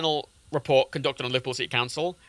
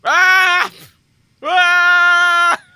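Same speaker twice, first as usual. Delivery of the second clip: real yelp, high and loud; startled shout; 10 dB louder than usual. Real, not acted. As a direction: cry out in sudden alarm. Something snaps on stream.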